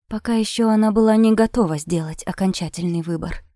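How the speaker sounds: ordinary delivery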